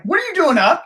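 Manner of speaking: high-pitched startled question; startled dad. Retold or imitated. Dad catching his kids up at night, startled.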